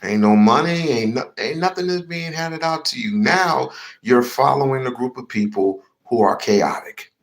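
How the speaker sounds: ordinary talk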